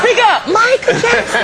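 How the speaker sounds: high, slow laughing call to speak up; laughing, teasing plea; mock demand. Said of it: "He talked so low".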